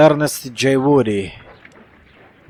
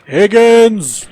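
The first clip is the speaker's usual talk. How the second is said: high, loud shout of a name; calling out; 9 semitones higher, 9 dB louder than usual. Pretend. Player summoning the detective's young helper, calling out loudly. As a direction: holler it out, raised and drawn out. Calls out to a game character.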